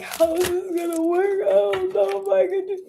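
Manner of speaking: high, fretful worried voice; anxious inner voice. Retold or imitated. Reader mimics a viewer's anxious doubts in a high voice. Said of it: "If you're like".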